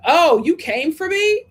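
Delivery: hammed-up indignant surprise; mock surprise. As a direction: play Rob's shocked reply. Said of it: "even Rob is like".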